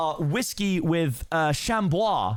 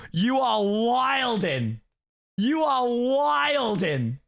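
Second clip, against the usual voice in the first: very slow, stretched scoffing; drawn-out disbelief; 6 semitones higher, much slower than usual. Real, not acted. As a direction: stretch every word out in outraged disbelief. Scoffing at a drinking challenge.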